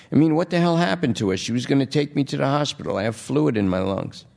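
high, fast, fretting junkie voice; a hint of a twitchy addict worried for his girl; anxious junkie. Junkie Lester frets to the detective, anxious and fast.

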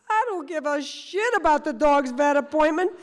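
high, loud, gleeful outburst; thrilled shout. Retold or imitated. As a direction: crow it out like a thrilled old boat captain. Thrilled boat captain exclaims.

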